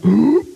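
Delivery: sharp high gasp of shock. Acted. Mourner peeking into the coffin, shocked gasp. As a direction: gasp as if seeing the dead.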